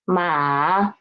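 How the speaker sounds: ma low, dipping; low dipping tone demo; third tone ma. Shown as a demonstration. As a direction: dip ma low then up. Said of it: "then the four tone is".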